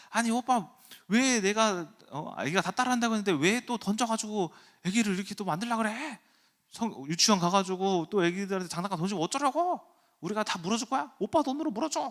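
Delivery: high-pitched nagging wife imitation; imitated angry wife. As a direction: scold the husband in a mock wife voice. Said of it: "화를 내는 거예요". (화난 아내 흉내로 높게 따지기)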